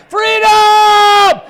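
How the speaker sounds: huge high shout of Freedom; dog hollering a battle cry. Pretend. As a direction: roar it like a war cry. Braveheart-style battle cry by dog.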